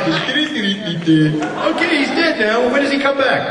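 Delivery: high, perky voice playing the naive actor; eager questioner. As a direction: ask in mock innocence, pitched well up. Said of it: "I'm like".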